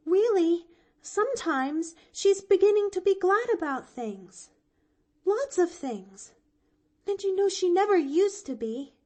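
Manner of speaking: put-on high, loud, heartfelt young girl; child pleading sincerely; earnest pleading girl. A narrator's reading. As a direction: plead her aunt's case. Pollyanna pleading with him.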